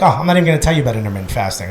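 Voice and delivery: rattled off fast, lightly voiced doctor; quick doctor quote. Doctor brushing him off, said fast. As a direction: rush it like a tired doctor. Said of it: "So she was like".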